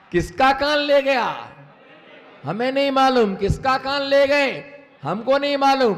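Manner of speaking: mock village chatter; villagers back and forth. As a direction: bounce question and denial. Villagers trading questions and denials.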